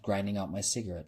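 usual speaking voice

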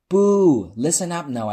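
high, loud call over a booing crowd; loud pleading. A narrator's reading. Host pleads with the rowdy drag club audience, high and loud. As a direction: beg the crowd to listen. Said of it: "I pleaded".

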